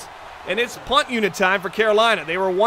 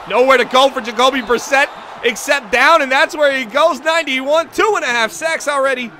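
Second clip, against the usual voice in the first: loud, raised-pitch play-by-play; excited sack call; 4 semitones higher, 7 dB louder than usual. Real, not acted. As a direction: belt out the sack as it happens.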